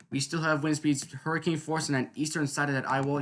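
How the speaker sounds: ordinary talk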